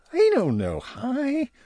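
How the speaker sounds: high squeaky elf voice; a small eager elf; high-pitched elf answering; Barnaby the elf. Acted. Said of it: "Barnaby replied".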